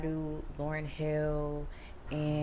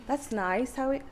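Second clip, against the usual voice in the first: put-on British accent; 2 semitones higher than usual. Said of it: "I could talk in, like, a British accent".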